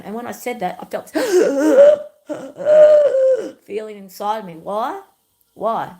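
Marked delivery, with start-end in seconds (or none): 1.2-3.6 emotional gasp. Real, not acted. Overwhelmed after owning it.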